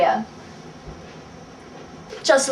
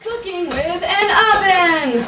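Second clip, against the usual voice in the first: melodramatic wail; 5 semitones higher, slower than usual. Acted. Wailing show title.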